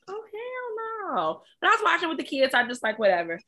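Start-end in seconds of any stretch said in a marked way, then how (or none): 0.1-1.4 drawn-out "hell no". Retold or imitated. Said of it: "was like".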